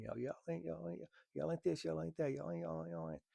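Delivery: mild mock of a shouting crowd. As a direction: parrot their yelling back, mockingly. Mocking the yelling bystanders.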